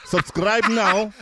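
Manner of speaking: slow, drawn-out put-on Russian accent; mock Russian accent. Guest hamming a Russian accent for the podcast audience, slow. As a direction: ham up a Russian announcer, slow. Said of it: "Yeah, that is class".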